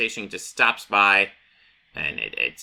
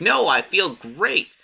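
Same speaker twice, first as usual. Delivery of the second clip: manic Joker; 6 semitones higher than usual. Retold or imitated. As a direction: savour it like a happy madman. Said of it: "And he's like".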